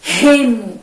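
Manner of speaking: "remo" with a loud, breathed h-like R; strong initial R. Shown as a demonstration. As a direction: punch the strong R that opens "remo". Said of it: "Sai o som bem forte".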